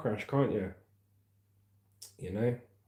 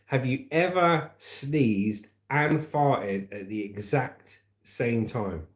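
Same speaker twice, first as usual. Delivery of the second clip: slow drawn out; much slower than usual. Acted. Drawn-out question to viewers.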